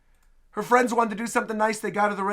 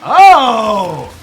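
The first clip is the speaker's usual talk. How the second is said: loud, drawn-out falling oh; surprised exclamation; 2 semitones higher, 13 dB louder than usual. Real, not acted. Streamer reacting to the Lighter demo, surprised exclamation. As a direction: burst out, impressed. Startled reaction to trailer action.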